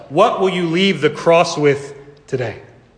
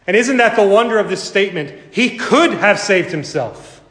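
impassioned preaching; 5 semitones higher than usual. Acted. Preacher to congregation, rising impassioned preaching. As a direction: build the crowd to a peak. Rises to full preaching again.

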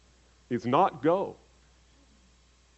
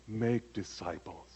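soft make disciples; 3 semitones lower, 11 dB quieter, slower than usual. Shown as a demonstration. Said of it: "It's as you are going".

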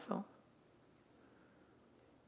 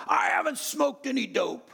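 put-on high, loud teen shout; shouted denial; 12 semitones higher, 6 dB louder than usual. Son shouting his denial in the ER waiting room. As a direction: yell it in his father's face.

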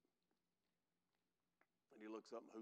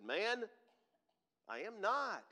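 loud, higher protest; emphatic denial; 9 semitones higher, 9 dB louder, slower than usual. Acted. Peter denying to a bystander, loud and high. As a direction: push back hard, pitched up. Peter denies a second time.

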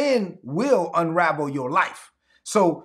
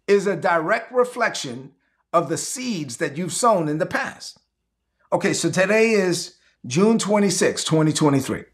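ordinary talk

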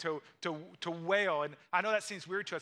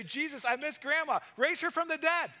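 high, louder whiny plea; mock pleading crowd; 8 semitones higher, 5 dB louder than usual. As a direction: ham up a desperate request. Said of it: "bring their grandma's bones and say".

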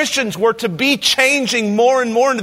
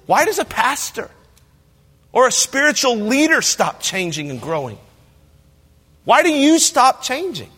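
ordinary talk, nothing marked

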